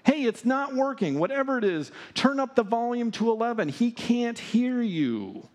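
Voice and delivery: high-pitched incredulous heckling; mocking. Elijah heckles prophets whose god stays silent, mocking. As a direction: jeer at them, drawing out the final taunt. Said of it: "Elijah is mocking them".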